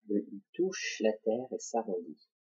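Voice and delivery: normal talking voice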